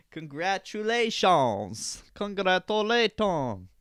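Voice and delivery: high, loud, slow congratulations; sarcastic cheer; drawn-out ironic celebration; mock celebration. Real, not acted. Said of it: "celebrates wasted episode".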